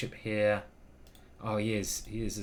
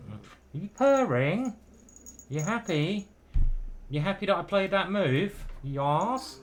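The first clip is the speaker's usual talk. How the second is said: cooing pet talk; 7 semitones higher, 3 dB louder, slower than usual. Real, not acted. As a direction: ask the cat sweetly if it's happy. Talking to purring cat.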